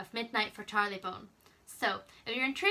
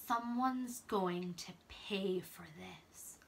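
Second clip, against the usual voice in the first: slow, breathy threat under the breath; menacing whisper; 3 semitones lower, 6 dB quieter, much slower than usual. Acted. Voice in the picture whispering a slow threat.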